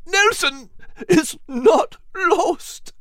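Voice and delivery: high, slow voice cracking with joy; tearful joyful cry; crackled out. Acted. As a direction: choke the words out through a lump. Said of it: "he crackled out".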